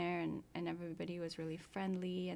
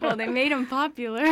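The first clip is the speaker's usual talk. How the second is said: louder and higher, through laughter; laughing; 6 semitones higher, 15 dB louder than usual. Real, not acted. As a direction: answer while cracking up. Joking about Panama hats.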